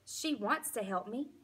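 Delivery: usual speaking voice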